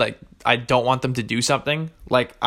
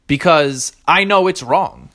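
ordinary delivery

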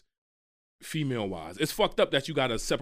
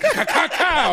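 camera click noises; 8 dB louder, much slower than usual. Retold or imitated.

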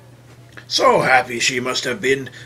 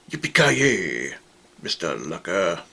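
mock action hero line; 11 semitones lower, 3 dB quieter than usual. Gloating catchphrase over falling villain.

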